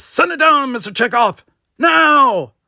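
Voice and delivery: high, loud command; urgent shout. Acted. Kirk shouting to Chekhov to fire now.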